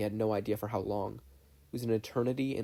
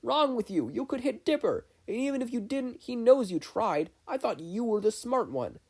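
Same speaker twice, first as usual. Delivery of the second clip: angry yell; 12 semitones higher, 4 dB louder than usual. Acted. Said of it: "Grunkle Stan was yelling something".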